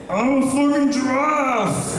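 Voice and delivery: high, drawn-out mock giraffe yell; giraffe impression. Comic not even trying with the joke, high mock giraffe shout. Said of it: "here's my giraffe".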